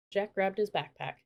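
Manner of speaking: ordinary delivery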